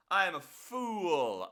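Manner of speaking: slow, loud mock lament; dramatic self-mockery. Real, not acted. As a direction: wail it like a tragedy.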